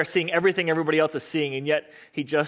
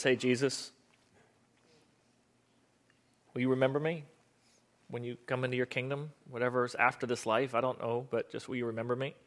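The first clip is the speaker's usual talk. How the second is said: soft, low, hesitant plea; humble prayer; 3 semitones lower, 9 dB quieter than usual. Acted. Repentant thief asks Jesus to remember him, humbly praying. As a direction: ask quietly, with long pauses. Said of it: "it's just this prayer".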